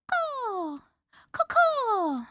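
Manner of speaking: bird caws. Retold or imitated. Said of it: "The mitre bird says".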